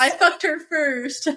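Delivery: high, slow mock boast; petty bragging. Co-host outdoing the host as petty ex, gloating. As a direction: draw it out as a petty, gloating one-upper. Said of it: "yeah, be petty".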